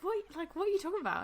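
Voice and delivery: high-pitched baffled retelling of Rick; high confused mimic. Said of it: "he was like".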